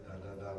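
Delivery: tongue "Da" a little further back. Shown as a demonstration. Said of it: "da is a little further back".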